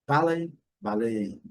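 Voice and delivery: ballet first as BAL-ay, then bal-AY; British then American stress. Shown as a demonstration. Teacher contrasts both accents, slow stress demo. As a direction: slowly shift the stress from first to second syllable. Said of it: "No inglês britânico".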